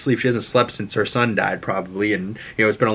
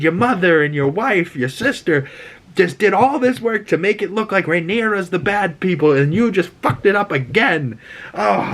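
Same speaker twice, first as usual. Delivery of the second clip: higher, put-on voice berating a fool; scolding old statesman; 8 semitones higher than usual. Otto scolding Aegon, higher put-on exasperated voice. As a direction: tear into the foolish king.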